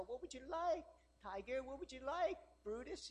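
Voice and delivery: high, soft cooing to a dog; mock pampering pet-owner voice; doting owner. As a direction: coax the dog sweetly, softly. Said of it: "the owner, when the dog barks".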